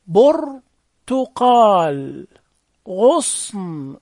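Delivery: برتقال and غصن stretched out sound by sound; very slow modelling. Shown as a demonstration. Teacher practising damma with pupils, very slow modelling.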